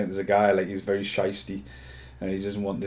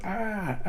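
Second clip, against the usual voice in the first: short high mock screams; hammed-up high scream; mock girl scream; 12 semitones higher than usual. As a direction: squeal like a film heroine. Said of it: "this girl scream effect".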